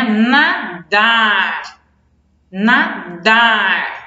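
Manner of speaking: nadar split slowly, long strong DAR; slow teaching demo of nadar, NA then DAAR; stress on last syllable. Shown as a demonstration. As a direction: lean on DAR and hold it. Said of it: "O dar também é mais forte".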